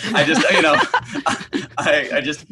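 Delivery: laughing through the words; breaking into laughter mid-sentence; giggling while talking. Real, not acted.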